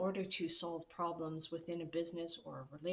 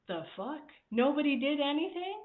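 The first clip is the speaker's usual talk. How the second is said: high, loud incredulous outburst; mock explode in disbelief; shocked disbelief; 8 semitones higher, 7 dB louder than usual. Boss finding nothing was done, incredulous. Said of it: "And you're like".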